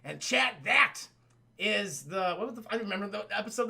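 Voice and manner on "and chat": ordinary talk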